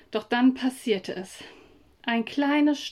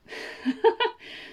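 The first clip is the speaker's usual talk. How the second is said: lachen; 11 semitones higher than usual. Acted. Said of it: "Sie musste lachen".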